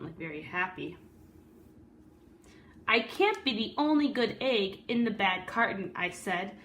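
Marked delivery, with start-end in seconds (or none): 2.9-5.9 lively read-aloud storytelling voice; story narration. A narrator's reading. Teacher reading a picture book to the class, storyteller voice.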